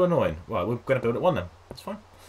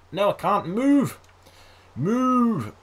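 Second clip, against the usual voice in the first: whiny frustration; 10 semitones higher, 5 dB louder, much slower than usual. Real, not acted. Character stuck in rain.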